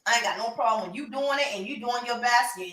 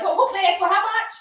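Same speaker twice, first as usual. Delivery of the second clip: mock incredulous; 9 semitones higher, 5 dB louder, faster than usual. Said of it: "I have to be the one like".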